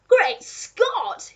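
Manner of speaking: high, loud astonished exclamation; duke gasping. A narrator's reading. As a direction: exclaim it as if staggered by the sight. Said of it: "he gasped".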